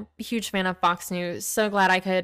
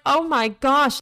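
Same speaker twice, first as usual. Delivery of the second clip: higher, appalled exclamation; retold dismay; 6 semitones higher, 4 dB louder, slower than usual. Woman reacting to her shouting date, appalled. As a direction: replay an appalled reaction, pitch raised.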